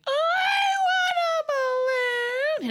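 put-on high, crying little kid's wail; whiny child. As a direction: wail it like a small child who wants the balloon. Kid begging clown for balloon.

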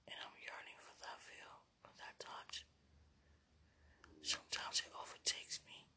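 breathy unvoiced whisper, very soft; intimate whisper. Real, not acted. Whispering longingly to her beloved. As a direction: whisper it close, like a secret to a lover.

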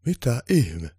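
taken aback; baffled. A narrator's reading. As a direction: blurt it out in puzzlement. Santa baffled at missing sleigh.

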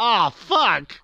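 sudden high groan of dismay; dismayed curse. Retold or imitated. Retelling the movie scene to a friend, a high dismayed curse. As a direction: blurt it out like everything just fell apart.

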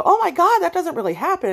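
mock them, high and loud; mocking skeptic. People denying bathroom harassment, high scoffing mockery. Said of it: "So when people go".

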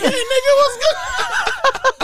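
put-on very high, squeaky comic baby voice; baby's imagined reply. Comedian voicing a baby greeting him back, squeaky and cheeky. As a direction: squeak it out high, laughter creeping in. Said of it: "He's like".

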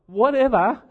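indignant, high and loud; Whatever? repeated high and loud; outraged echo. Retold or imitated. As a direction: throw the word back in disbelief. Outraged echo of staff reply.

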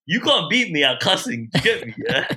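light Croydon slang voice; south London accent. Real, not acted. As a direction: lean into Croydon street talk. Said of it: "I'm from Croydon".

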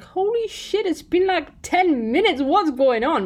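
high-pitched exasperated surprise; retold disbelief. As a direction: react in mock shock, pitched well up. Acting out her surprised reaction.

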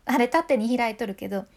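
ordinary talk, nothing marked

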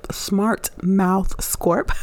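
spaced out slowly so listeners catch it; slow, careful repeat. Real, not acted. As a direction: stretch out each word of the handle. Repeats her Twitter handle slowly.

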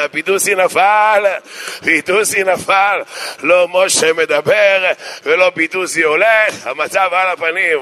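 slow mock-tragic proclamation; hammed-up, slow report of a flop; drawn-out announcement. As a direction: stretch each phrase out grandly for the disaster. Mock announcement of failed miracle.

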